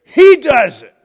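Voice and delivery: a condemned man rebuking the mocker; rebuking thief. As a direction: scold the other thief with force.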